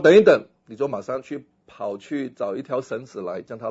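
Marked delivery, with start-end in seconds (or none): 0.0-0.5 1 urgent call. Pretend. Calling to man in well.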